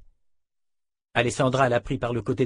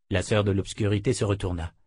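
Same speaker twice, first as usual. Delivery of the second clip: ordinary delivery, nothing marked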